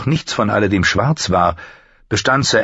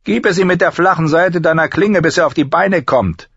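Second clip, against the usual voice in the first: high-pitched, sneering drill master; mocking master-at-arms; 11 semitones higher, 3 dB louder than usual. Master-at-arms ridicules the fat new recruit, mocking. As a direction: jeer at the recruit in a raised, cutting voice.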